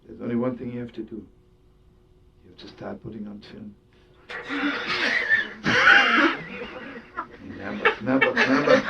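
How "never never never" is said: laughing. Real, not acted. Laughing at the punchline.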